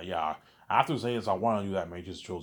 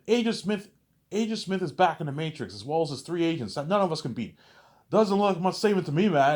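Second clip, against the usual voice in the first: higher and louder, furious; yelling; 11 semitones higher, 9 dB louder than usual. Retold or imitated. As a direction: shout him down in the argument. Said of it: "You see Michael Jordan, like, yelling".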